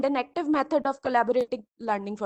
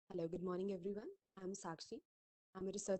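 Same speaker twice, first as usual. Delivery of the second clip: very faint, distant voice; speaking almost too softly to hear; barely audible; 5 semitones lower, 17 dB quieter, faster than usual. Real, not acted. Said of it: "your voice is very low".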